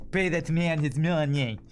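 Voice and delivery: slow, thick mock Russian accent; John Malkovich Russian accent. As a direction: drag each word out like a movie gangster with a Russian accent. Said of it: "Something like that".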